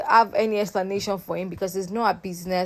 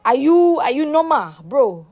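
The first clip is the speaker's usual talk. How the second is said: higher and louder, in disbelief; incredulous outburst; 4 semitones higher, 4 dB louder than usual. Retold or imitated. Vlogger reacting to Romel's question, loud and incredulous. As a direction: blurt out mocking disbelief. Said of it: "I'm like".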